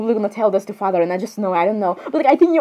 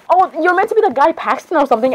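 normal talking voice

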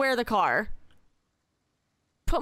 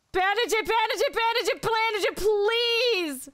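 high whiny pleading; 7 semitones higher than usual. Real, not acted. Pleading repeatedly.

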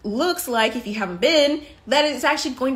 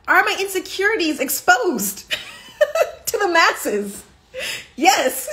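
higher-pitched, laughing through the words; real laugh breaking into question and answer; 8 semitones higher than usual. Real, not acted. Admitting insecurities are exposed to the masses, laughing.

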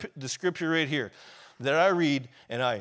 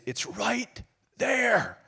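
high, loud anguished yell; retold scream of frustration from finding it in the Bible; 12 semitones higher, 8 dB louder, slower than usual. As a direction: shout it out, slow and desperate at first. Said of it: "I start yelling and screaming".

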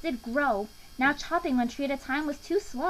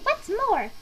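high, indignant creature voice; snapping Lorax; 10 semitones higher, 4 dB louder than usual. A narrator's reading. Lorax pressing his complaint on the Onceler, snapping. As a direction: snap it out, pitched high. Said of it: "snapped the Lorax".

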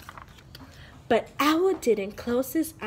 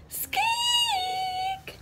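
very high, soft mouse squeak; tiny high squeak; 5 dB quieter than usual. Acted.

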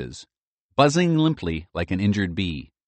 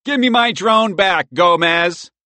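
bully yelling, higher and louder; hollering; 11 semitones higher, 8 dB louder, slower than usual. A narrator's reading. Bully Edgar yells at Michael for his drone, hollering. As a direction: holler it across the yard like a bully. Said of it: "he hollered".

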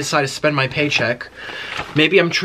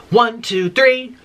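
rising higher count; excited countdown; 7 semitones higher, much slower than usual. Real, not acted. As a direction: build to the reveal. Countdown to reveal.

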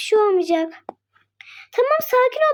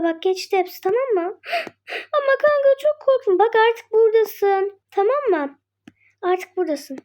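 calm her down gently; soothing. Acted. Soothing scared friend after escape.

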